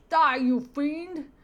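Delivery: loud, slow, high battle cry; hammed-up cartoon cop shout. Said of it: "stereotype cop".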